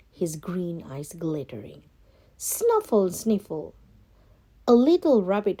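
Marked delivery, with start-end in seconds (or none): 2.4-3.1 high playful snuffle; sniffing sound. Acted. Rabbit snuffles toward the food, high sniffing noise.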